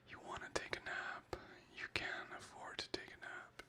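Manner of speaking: soft unvoiced whisper to chat; breathy close whisper greeting viewers; ASMR whisper. Real, not acted.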